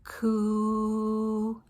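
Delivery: "Coo" with a long, level oo; k gliding into a drawn-out oo in "Coo"; stretched qu sound. Shown as a demonstration. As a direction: hold "Coo" as one steady "kwooo". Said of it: "Listen to it again".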